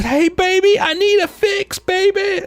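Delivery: very high, pleading put-on woman's voice; hammed-up high desperate woman voice; sultry junkie voice. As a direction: beg in a squeaky needy falsetto. Jamie Lee Curtis acting junkie.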